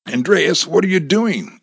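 high, loud, startled question; alarmed question. Acted. Hostage to her armed friend, startled alarm. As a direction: blurt it out in alarm.

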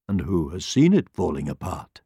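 low, measured question; wise lion. A narrator's reading. Lion questioning the rhino, calm and low.

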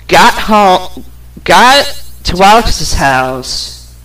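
ordinary delivery

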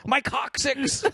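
high-pitched fake agony; mock pained wail. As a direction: squeal over an imaginary injury. Acting out rope swing injury.

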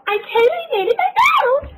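crying, high-pitched brag; whiny crying shout. Pretend. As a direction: wail it like a proud kid. Mock proud crying shout.

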